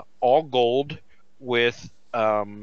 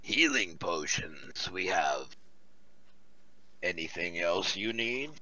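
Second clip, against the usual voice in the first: smoker voice; 6 semitones lower, 9 dB quieter than usual. Acted. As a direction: purr it like a chain smoker.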